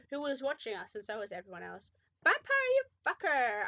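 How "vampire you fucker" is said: high, loud acted fury; angry outburst. A narrator's reading. Said while slapping him, high hammed-up anger. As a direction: spit the insult out loudly.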